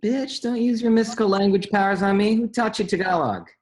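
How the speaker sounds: high, loud playful indignation; mock outrage. Rock teasing his friend about her language powers, loud and high. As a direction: yell it in teasing disbelief.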